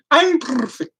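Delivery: high mock posh reply. Cat collar's nice phrase in reply, very posh. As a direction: answer nicely in a put-on posh accent. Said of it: "come out with very posh, like, nice, like, phrases".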